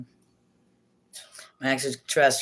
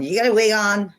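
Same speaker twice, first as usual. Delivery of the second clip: mocking Joel; 6 semitones higher, 4 dB louder than usual. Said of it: "'cause I know Joel's gonna go on".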